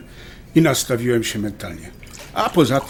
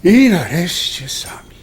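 relieved, romantic; 6 semitones higher, 6 dB louder, slower than usual. Acted. Couple alone at dinner at last.

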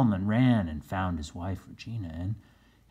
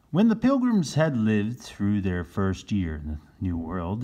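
plain reading voice

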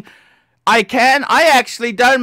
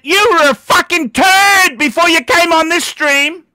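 angry; 6 semitones higher, 3 dB louder than usual. Real, not acted. Angry streamer insulting a caller.

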